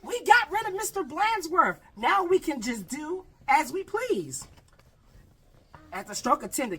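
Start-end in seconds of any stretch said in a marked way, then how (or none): 0.0-4.5 put-on gleeful kids. Kids celebrating in story.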